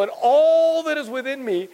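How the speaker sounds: ALL stretched long, high and loud; emphatic quote. Acted. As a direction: swell on "all" and hold it. Emphatic excited quote of David.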